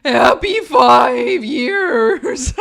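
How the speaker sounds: loud, very drawn-out put-on voice; over-the-top, booming mock greeting; hammed-up Super Packs impression. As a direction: bellow it slowly, stretching each word, as a joke. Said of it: "read it in my best super packs".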